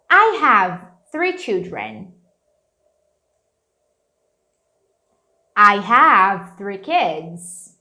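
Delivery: slow, clear drill lines with pauses; slow repeat-along drill. Shown as a demonstration. Teacher leading a repeat-along drill. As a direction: read slowly so students can follow. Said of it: "repeat after me".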